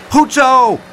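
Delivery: high, loud cheer; shouted. A narrator's reading. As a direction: shout it in triumph. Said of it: "schreeuwde Pluk".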